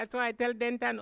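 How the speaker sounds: ordinary talk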